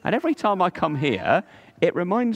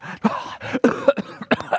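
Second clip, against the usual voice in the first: 1 spluttering cough; 6 semitones higher than usual. Acted. Boy surfaces from the dive.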